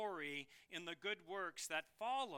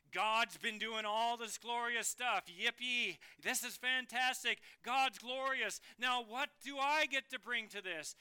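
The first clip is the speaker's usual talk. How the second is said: mock enthusiasm; 4 semitones higher, 7 dB louder than usual. Mock cheer about God's glory.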